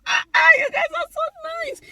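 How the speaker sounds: squealing delight. Real, not acted. Gushing thanks to subscribers.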